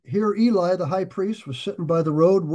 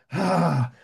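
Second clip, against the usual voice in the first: villain; 5 dB louder than usual. Retold or imitated. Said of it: "this one really evil character".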